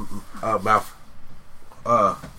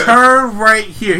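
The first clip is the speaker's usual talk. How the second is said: slow, louder, higher sat-nav voice; lightly voiced GPS; 8 semitones higher, 8 dB louder, much slower than usual. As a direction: read it like a slow robotic navigator. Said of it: "started acting as a G P S".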